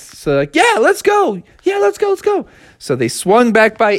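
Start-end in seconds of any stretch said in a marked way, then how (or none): 0.6-2.5 eager cheer. Acted. Bears eager, high voice.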